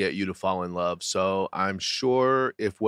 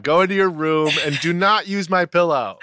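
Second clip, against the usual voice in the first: mock scold; 8 semitones higher than usual.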